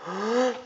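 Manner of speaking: quick high in-breath before a sneeze; sharp gasp. Acted.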